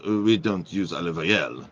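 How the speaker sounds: mock French waiter confessing; French accent. French waiter back from the kitchen confesses, French accent. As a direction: admit it in a put-on French accent. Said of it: "So the waiter goes in this French accent".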